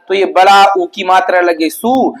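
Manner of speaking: ordinary talk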